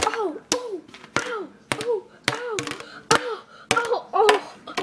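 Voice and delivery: high, soft yelps of pain; pained whimpering. Acted. Mario hurt by the blow, whimpering in pain. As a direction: whimper small repeated ows.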